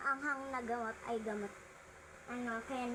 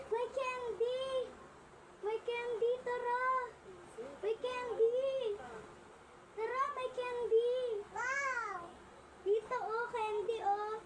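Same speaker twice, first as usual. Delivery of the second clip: high, lively coaxing calls; excited calling; 8 semitones higher than usual. Real, not acted. Calling a child excitedly, candy.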